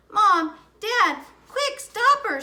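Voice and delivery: high, loud urgent plea to parents; alarmed boy. Acted. Brother urging parents to stop the flood, high and loud. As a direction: sound the alarm to mom and dad.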